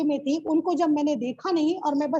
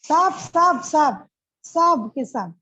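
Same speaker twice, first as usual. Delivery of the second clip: louder, then drawn out slowly; joyful emphasis; 6 dB louder, much slower than usual. Real, not acted. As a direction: savour each word with joy. (खुशी से ज़ोर देकर, धीरे-धीरे)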